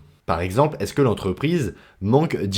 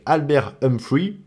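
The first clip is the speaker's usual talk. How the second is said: name said slowly in an English accent; 6 semitones higher, slower than usual. Shown as a demonstration. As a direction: pronounce Albert Humphrey the English way, slowly. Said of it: "désolé mec pour mon accent anglais". (prononcé avec un accent anglais)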